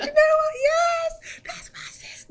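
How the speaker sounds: high, loud re-enacted scream; squealing cheer that breaks into breathiness. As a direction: scream with pride for a sister. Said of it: "I was screaming for her".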